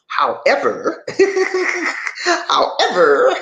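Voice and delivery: chuckling through the word however; laughing. Real, not acted. Laughs before a punchline.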